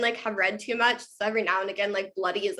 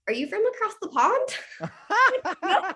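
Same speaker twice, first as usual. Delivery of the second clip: laughing quote; 9 semitones higher, much slower than usual. Retold or imitated. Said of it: "everyone's always like".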